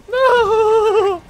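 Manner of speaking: high wailing no; drawn-out "no" cry. Real, not acted. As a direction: stretch it out high in dismay. Cries out after throwing grenade.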